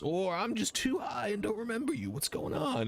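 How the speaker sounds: high-pitched stoner voice; stoned dragonborn. Acted. Dragonborn just smoked weed and greets strangers, stoned voice. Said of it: "drag of weed and just goes".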